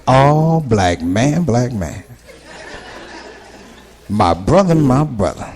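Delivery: low, drawn-out preachy brother; recruiting brother. Retold or imitated. Street recruiter to a new convert, low preachy call. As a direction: call him in like a Nation recruiter.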